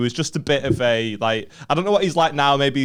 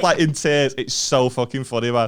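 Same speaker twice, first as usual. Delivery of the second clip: usual speaking voice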